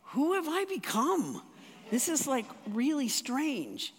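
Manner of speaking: high, baffled self-question, drawing out really strange; amazed. Retold or imitated. Telling the congregation about her own reaction, astonished and high. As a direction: marvel at the change in yourself, up high.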